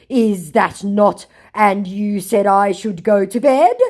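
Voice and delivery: mock scammer voice. Mocking the scammer's clumsy reply, slow and stilted. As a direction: drag it out like a clumsy con man.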